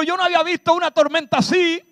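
high, fast mock panic; hammed-up fear of the storm; mock alarm. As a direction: rattle it off like a scared sailor. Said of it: "Jesús no dijo".

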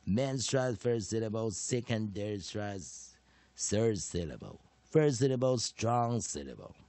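measured, emphatic classroom chanting of each rule; phonics drill recitation. Shown as a demonstration. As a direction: recite it step by step, pausing between parts.

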